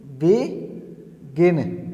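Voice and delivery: stretched out slowly, syllable by syllable; slow demo word. Shown as a demonstration. Teacher showing the syllables to students, said slowly. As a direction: draw out begin slowly so each syllable is heard. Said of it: "जैसे कि".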